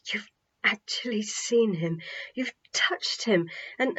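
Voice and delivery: whispered, in disbelief; awed whisper. A narrator's reading. Naomi repeating the news to herself, in a whisper. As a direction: whisper it to yourself. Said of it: "Naomi kept repeating".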